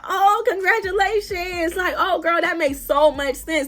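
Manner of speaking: slow mock delight, laying it on thick; trolling. Sisters trolling a rival who says she's pregnant, mock-sweet congratulations. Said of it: "me and my sister trolling".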